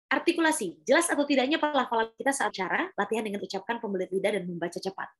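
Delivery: quick read-through with clear articulation; fast reading. Shown as a demonstration. Coach showing articulation practice to learners, fast and clear. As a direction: race through the text, every letter audible. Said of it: "bacanya kan cepat kan".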